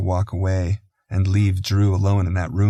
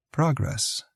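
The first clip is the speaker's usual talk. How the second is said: soft cooing; 7 semitones higher, 5 dB quieter than usual. A narrator's reading. Soothing the crying baby.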